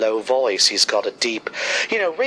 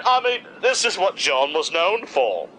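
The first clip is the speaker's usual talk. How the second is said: ordinary talk, nothing marked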